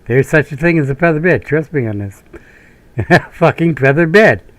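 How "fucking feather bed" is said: ordinary talk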